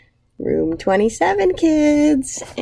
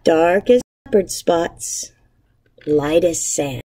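put-on reading to children. Teacher reading a picture book aloud to her class, gentle storytelling. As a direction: savour each image for young listeners.

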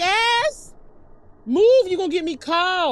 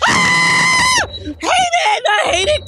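high panicked shouts; frantic screaming protest; scared shouting; 12 semitones higher, 4 dB louder, slower than usual. Real, not acted. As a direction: yell in real fright. Scared by monster.

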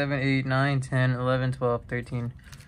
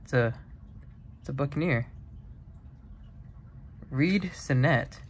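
ordinary delivery

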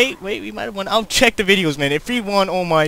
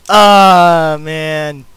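frustrated cry; 4 semitones lower, 14 dB louder than usual. Real, not acted. Missed the result.

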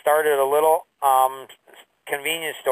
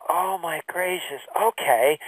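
softer, slow hushed oh my gracious; dawning realization; 2 semitones higher, 3 dB quieter, slower than usual. Retold or imitated. Realizing mentor Tom's point, hushed slow realization. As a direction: let it sink in, quietly.

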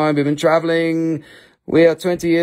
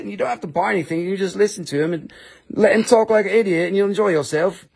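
usual speaking voice

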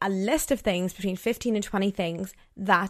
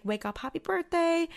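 much higher, bright sing-song wake-up call; sing-song birthday greeting; 10 semitones higher, 3 dB quieter than usual. Retold or imitated. Waking her boyfriend on his birthday, high sing-song cheer. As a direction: chirp it like waking someone on their birthday.

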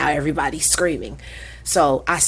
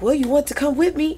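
put-on higher, quicker kid's voice; boy's high quick voice; 10 semitones higher, faster than usual. Student Diamond inviting his teacher in, high and quick. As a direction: voice the kid inviting her along. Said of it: "He's like".

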